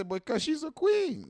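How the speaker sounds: high, slow, mocking lilt; mock reverent claim. Mocking what people say about women, high slow lilt. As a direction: drag it out like a grand excuse. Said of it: "They gonna say".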